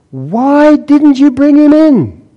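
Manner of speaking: high, slow, incredulous challenge; indignant demand. Acted. Chief priests scolding the guards, high indignant demand. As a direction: demand why the prisoner is missing.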